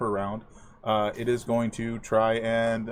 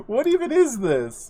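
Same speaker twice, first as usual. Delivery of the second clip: laughing disbelief; 11 semitones higher, 5 dB louder, slower than usual. Real, not acted. Laughing at bad rolls.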